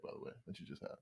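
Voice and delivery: almost whispered, trailing off; quiet aside. Real, not acted. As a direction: drop to a near whisper.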